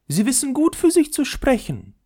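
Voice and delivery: very high, dainty ball voice; the leather ball snubbing the top, very high; haughty little ball. Acted. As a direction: look down your nose at the top.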